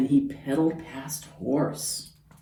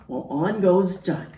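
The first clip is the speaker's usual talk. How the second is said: ordinary delivery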